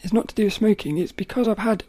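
usual speaking voice

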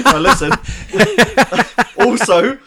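high, loud laughing words. Real, not acted. Laughing while lecturing.

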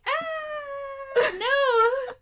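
high, squealing "Ah, no"; hammed-up panic at a wave; mock panicked scream. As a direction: shriek of fake fear at the water. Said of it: "and I'm like".

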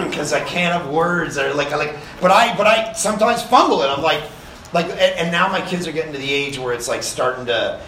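ordinary talk, nothing marked

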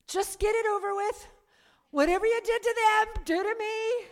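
high, loud retold outburst; defiant demand. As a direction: blurt it out loudly at the preacher. Blurts demand at the preacher.

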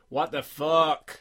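slow, loud mock Australian; an Australian accent attempt that slips toward Irish. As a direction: drag it out in a mock Aussie accent. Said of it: "that one I can do".

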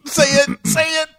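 very high, giddy egging-on; high-pitched anticipation; eager urging. Real, not acted. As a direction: squeak it in suspense, urging them on. Eagerly urging co-host to say title.

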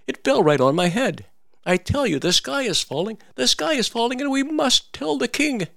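hammed-up frantic hen; insistent little hen. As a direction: insist in a squeaky hen panic. Hen insists sky falling.

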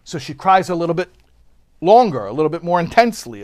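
ordinary delivery

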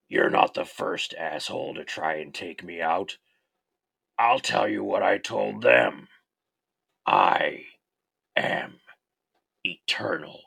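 lightly voiced menacing slasher; a hint of Robert Englund's Freddy; Freddy Krueger voice. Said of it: "my pseudo-best Robert Englund impression".

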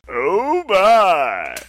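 high, loud, drawn-out exclamation sliding down; George Takei. Acted. Podcast host echoing George Takei's catchphrase, showy impression. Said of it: "George, George Takei".

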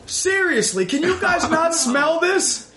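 high, complaining voice of someone choking on a smell; whiny sufferer. Pretend. Hosts playing characters, high whiny complaint about the smell. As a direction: whine like your nose is burning.

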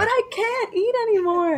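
put-on kid whining.

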